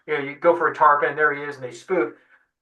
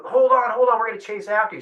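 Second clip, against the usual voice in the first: a hint of a higher call across the water; 6 semitones higher than usual. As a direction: lightly mimic a shout to a drifting kayak. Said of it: "I hear Decker go".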